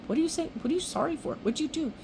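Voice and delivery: high, mildly baffled questions; lightly acted high-voiced bewilderment; retold puzzlement. Retold stranger puzzled by her apology.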